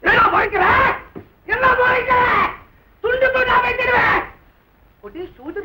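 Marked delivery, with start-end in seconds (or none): 0.0-4.2 furious threat. Acted. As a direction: threaten to cut him to pieces. Threatening to hack him.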